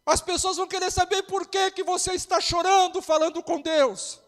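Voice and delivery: loud, high, rising pastor's cry; fiery preaching. Real, not acted. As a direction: preach it out to the whole church, building. Preaching at full force over music.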